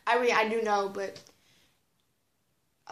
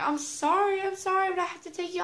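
higher-pitched retold pleading; insistent mock apology, pitched up; 7 semitones higher than usual. Best friend apologizing for taking her to the office, pleading.

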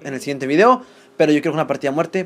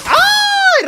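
very high drawn-out squeal; high shriek; 4 dB louder than usual. Real, not acted. Streamer as Raulito kills a player, high shriek. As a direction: wail "ay" way up high. Shriek on getting killed in game.

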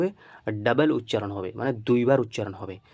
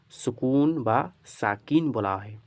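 সুকুন and Sakin drawn out slowly, long final vowels; slow teaching repeat; much slower than usual. Shown as a demonstration. Teacher repeating the term after asking students, slow and drawn out. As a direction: stretch সুকুন and Sakin for learners.

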